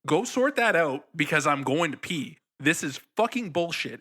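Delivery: high, loud angry rant. Retold or imitated. Guy tells off the volunteers, loud angry rant. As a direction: tell them off hard. Angry quarrel with theater staff.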